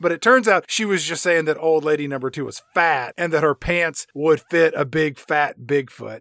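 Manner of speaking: usual speaking voice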